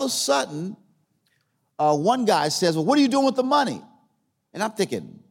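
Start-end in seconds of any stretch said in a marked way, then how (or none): 2.8-3.8 high, quick, pointed questioning; imitated prying question. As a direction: mimic a pastor prying, pitched right up. Pastor's pointed question, acted out.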